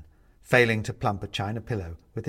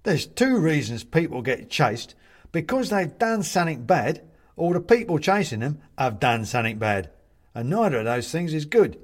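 raised, louder character voice; china man, opinionated; 5 semitones higher, 5 dB louder than usual. A narrator's reading. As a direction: lay out your reasoning emphatically. Man behind the door reasons.

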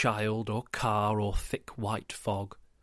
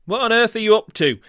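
loud, high, fast seagull cry; sudden cry; 9 semitones higher, 9 dB louder, faster than usual. Acted. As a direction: call out sharply like a gull. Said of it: "came a sudden cry".

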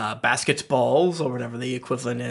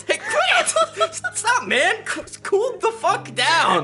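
high-pitched, half-laughing protest; slower than usual. Acted. Harper to upset Jayla after his joke, protesting. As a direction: fend someone off in a high, strained voice. Ghost fends off upset friend's apology.

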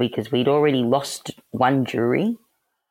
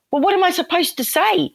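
much higher, squeaky mock-indignant protest. To Aaron in chambers, squeaky indignant protest. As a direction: pitch it way up, pleading innocence.

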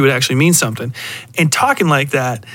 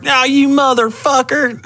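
hillbilly picking a fight; 12 semitones higher than usual. Retold or imitated. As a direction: ham up a hillbilly starting a brawl. Mocking a billy picking a fight.

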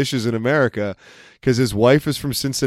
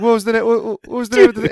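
higher, a bit louder put-on Italian accent; 10 semitones higher, 4 dB louder, faster than usual. Imitating the Italian guy at the party, higher, in an Italian accent. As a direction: ham up the Italian guy searching for a name. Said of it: "he was kind of talking like this".

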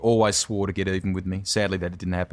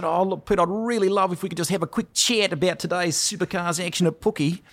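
high-pitched put-on New Zealand accent; prank-call as a chirpy Kiwi radio host; hammed-up, high Kiwi presenter voice; Kiwi radio announcer; 10 semitones higher than usual. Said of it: "this Kiwi radio announcer accent".

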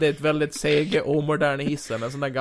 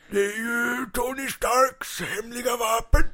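villain voice; 9 semitones higher, slower than usual. Acted.

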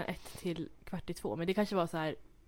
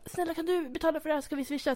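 put-on begging teenager; 8 semitones higher than usual. Tonåringar ber tanten betala.

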